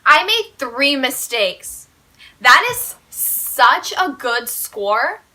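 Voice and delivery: loud, slow, drawn-out emphasis; proud boast. Real, not acted. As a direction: stretch out each word triumphantly. Bragging about few mistakes.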